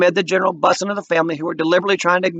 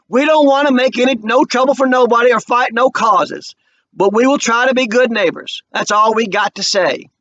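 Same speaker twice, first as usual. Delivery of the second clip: proud Walter; 8 semitones higher, 4 dB louder than usual. A narrator's reading. Walter refusing the offer.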